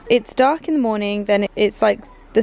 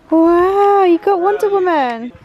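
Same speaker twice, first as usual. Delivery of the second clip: excited wow; 9 semitones higher, 7 dB louder, slower than usual. Real, not acted. Mother admiring her child's toy, loud high excitement. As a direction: make a big fuss over the toy.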